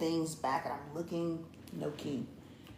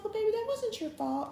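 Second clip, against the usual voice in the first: high, soft imitation of a wife; sweet; 13 semitones higher, 5 dB quieter than usual. Wife reassuring partner about the lost key, sweet. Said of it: "she was so sweet about it".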